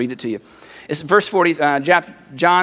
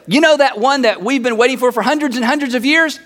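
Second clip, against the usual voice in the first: higher, eager build-up ending on a rise; excited friend; 4 semitones higher than usual. Acted. Friends walk up with long-awaited news, excited. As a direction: set up big news like a question. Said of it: "imagine this, a couple of your friends".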